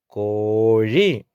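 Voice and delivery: കോഴി with a very long, held oo; long vowel. Shown as a demonstration. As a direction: slowly stretch the first vowel of കോഴി. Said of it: "ഒരുമിച്ച് പഠിക്കാം". (കോഴി എന്നതിലെ ഓ നീട്ടി)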